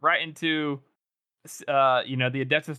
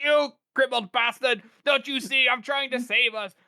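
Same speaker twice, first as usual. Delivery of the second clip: much higher, frantic raving; crazed Salt; 10 semitones higher, slower than usual. Retold or imitated. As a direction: rant like a desperate madman. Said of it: "he sounds crazy".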